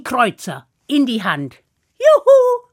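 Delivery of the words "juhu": high, loud whoop of joy; boy thrilled with first wages, shouting high; joyful cheer. Acted. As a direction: cheer like a kid just paid. Hans gets his first pay.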